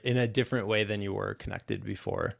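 ordinary talk, nothing marked

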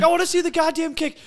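high, fast mock yell; high-pitched exasperated fan; retold heated protest. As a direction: yell back in a hurry, hammed up. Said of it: "I'm like".

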